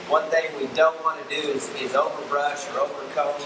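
usual speaking voice

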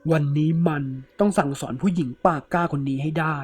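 plain reading voice

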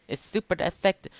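high-pitched excited game call; excited battle announcer. Acted. Reads battle message aloud, elated.

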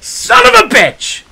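high, drawn-out cry of outrage; angry outburst. Retold or imitated. Driver reacting to being smashed into, angry outburst. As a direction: curse loudly on a high pitch. Retold outburst when rear-ended.

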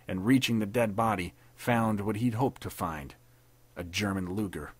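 plain reading voice

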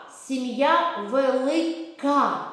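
sing-song rhyme; slow drill. Shown as a demonstration. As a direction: model it slowly for children. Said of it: "Повторіть".

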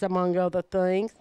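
normal talking voice

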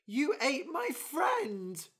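horrified bird. Acted.

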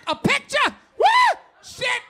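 high, loud whoop of delight; excited whoop. Pretend. Husband reacting to a racy photo, whooping excitedly. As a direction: whoop like you just got the picture.

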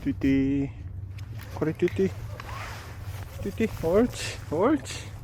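high cooing dog praise; squeaky praising a dog. Real, not acted. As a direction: coo praise at the puppy.

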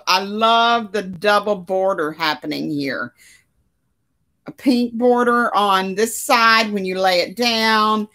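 ordinary talk, nothing marked

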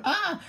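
very high, loud shriek; frightened scream. Acted. Asking if that thing is real, frightened scream.

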